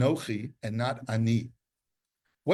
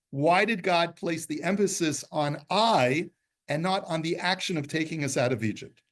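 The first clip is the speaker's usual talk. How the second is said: usual speaking voice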